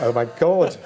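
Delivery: be bowled over; starstruck amazement. Retold or imitated. Stunned reaction retold.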